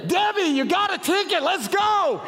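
high, loud call across the airport. Retold or imitated. Husband calling wife out of the restroom, loud and high. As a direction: shout for her to hurry.

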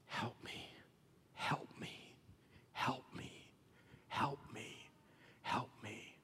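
repeated whispered plea, barely audible; desperate whisper. Acted. Canaanite woman begging Jesus, whispering desperately. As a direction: plead each help me on a breath.

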